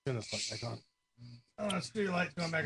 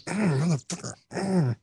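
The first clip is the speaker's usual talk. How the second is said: higher, grunting imitation of straining; straining with effort; 5 semitones higher, 3 dB quieter than usual. Storyteller reenacting forcing a cork back in, straining. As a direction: curse through a mock effortful grunt. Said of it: "trying to get this cork back in".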